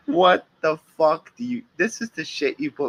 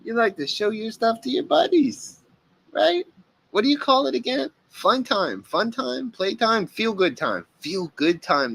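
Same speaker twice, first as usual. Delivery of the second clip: higher, sing-song taunting; mocking; 8 semitones higher than usual. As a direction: tease him with his own words, pitched up. Mocking the pervert's video titles.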